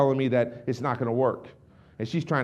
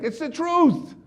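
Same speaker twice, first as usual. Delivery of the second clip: high-pitched protest; 11 semitones higher, much slower than usual. Retold or imitated. Speaker to shocked onlookers, high insistent protest. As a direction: voice leaping up in retold defence.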